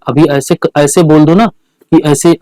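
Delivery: ordinary talk, nothing marked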